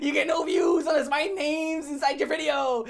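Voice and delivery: high, whiny mocking voice; mock whiny creator. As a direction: whine it like a crybaby.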